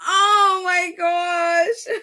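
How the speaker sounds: long, drawn-out wail through laughter; host overcome at being fooled, laughing hard; laughing exclamation. Real, not acted. Very slow, stretched-out laughing cry. Cracking up at the prank.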